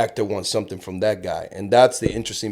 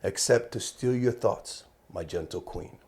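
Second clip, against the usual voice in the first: Shakespeare recital; 6 dB quieter than usual. Acted. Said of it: "That was good".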